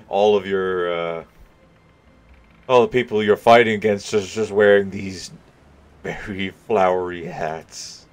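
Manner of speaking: amused and loud. Real, not acted. Laughing at flowery hats idea.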